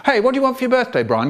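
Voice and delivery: put-on chatty kid. Child trying to distract.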